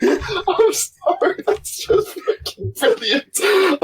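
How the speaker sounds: high, slow wheezing through laughter; real uncontrollable laughing fit; helpless laughter. Real, not acted. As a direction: squeeze the words out while cracking up.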